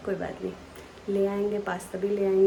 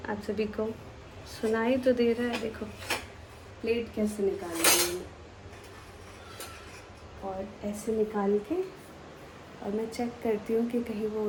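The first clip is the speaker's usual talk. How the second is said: softly; 6 dB quieter than usual. Real, not acted. Mother speaking softly while her baby sleeps nearby. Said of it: "मैं इतनी धीरे धीरे बोल रही हूँ".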